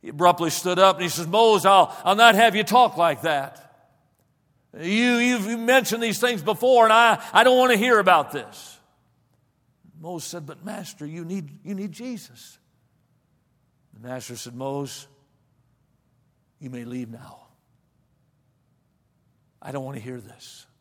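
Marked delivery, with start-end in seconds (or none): 1.3-8.8 louder, stern rebuke; angry rebuke. Acted. Master abruptly stands, rebukes Mose. 10.4-12.6 softer, quietly insistent; gentle persistence. Acted. Mose softly persists after rebuke. 14.5-20.7 lower, softer, cold dismissal. Acted. Master coldly dismisses Mose.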